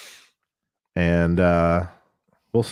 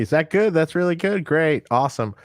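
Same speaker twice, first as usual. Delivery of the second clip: fawning musician; 7 semitones higher than usual. Retold or imitated. Musicians sucking up to sound guy.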